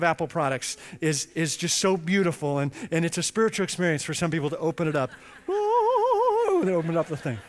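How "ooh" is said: very high, breathy falsetto ooh of delight; mock rapture; awed ooh. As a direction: coo like unwrapping something precious. Awe at opening Apple packaging.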